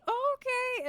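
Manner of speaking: friend voice. Retold or imitated.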